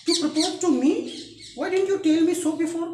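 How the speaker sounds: high, loud, indignant questioning; shocked disbelief. Acted. Daughter learns of the marriage proposal from her father, shocked disbelief.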